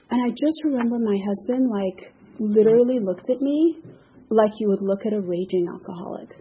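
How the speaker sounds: usual speaking voice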